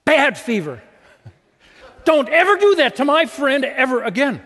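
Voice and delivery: high, loud, laughing mock scold at the fever. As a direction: scold the fever like a naughty dog. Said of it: "So the fever got a scolding".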